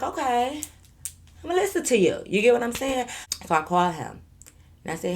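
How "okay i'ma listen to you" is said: mock agreeable. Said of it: "Someone was in my ear telling me that".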